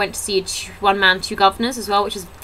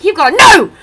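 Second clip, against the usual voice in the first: much higher, louder mock angry refusal; mock angry outburst; 13 semitones higher, 10 dB louder than usual. Comedian refusing the same front-row chat, mock angry shout. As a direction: shout him down in mock fury. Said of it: "'cause he was angry".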